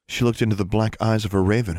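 ordinary delivery, nothing marked

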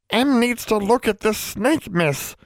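high, slow, insistent elf; serious high-pitched insistence. A narrator's reading. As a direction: state it slowly and gravely in a high voice. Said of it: "The elf sounded very serious".

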